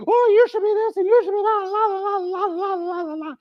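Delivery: high chattering mom voice, fading out; a son mocking his nagging mom, high and fading; mimicking mom. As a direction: rattle off mom's advice up high then trail away. Son voices his mom's advice.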